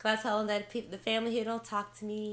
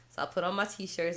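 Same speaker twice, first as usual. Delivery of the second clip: normal talking voice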